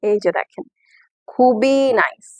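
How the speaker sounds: usual speaking voice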